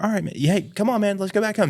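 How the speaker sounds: high coaxing voice; coaxing the dog. Retold or imitated. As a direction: urge the dog home kindly.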